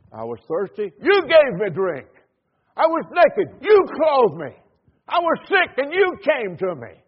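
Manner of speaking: loud, ringing pulpit challenge; preaching at full force. Acted. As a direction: drive it home to the congregation.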